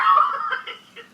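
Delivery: squealing laugh, pitch way up; can't hold the laugh in; high burst of laughter. Real, not acted. Host cracking up after call.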